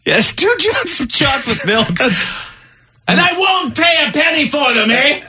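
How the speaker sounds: squeaky taunt in a mock Canadian accent; the thief gloating at the cashier, high mock Canadian voice; mock Canadian woman. Voicing the chocolate-milk thief.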